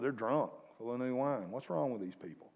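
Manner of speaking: normal talking voice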